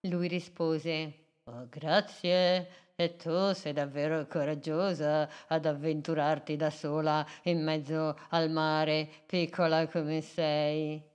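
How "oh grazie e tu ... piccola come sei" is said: put-on a wise old sea creature, taking his time; kindly octopus voice. Octopus Doroteo speaks.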